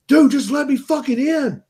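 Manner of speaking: high-pitched, imitating a desperate friend; mock panicked begging at a door; retold frantic plea. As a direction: act out a friend pleading to get in. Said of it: "screaming bloody murder".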